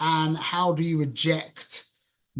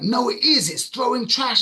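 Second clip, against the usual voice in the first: high, insistent comeback; indignant protest; 8 semitones higher, 3 dB louder than usual. Retold or imitated. Arguing with words in his head, indignant. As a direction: re-enact a worked-up contradiction.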